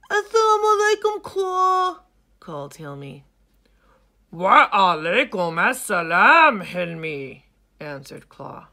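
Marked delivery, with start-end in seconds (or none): 0.1-2.0 high, loud, quick hippo call; young hippo calling. Acted. Hilmi calls to lion. 4.3-7.4 put-on loud, booming old lion; old lion Claw.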